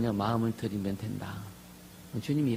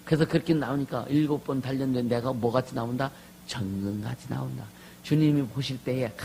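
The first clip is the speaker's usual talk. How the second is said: ordinary talk, nothing marked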